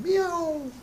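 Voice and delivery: high, thin imitated meow; cat meow. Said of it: "and the cat said".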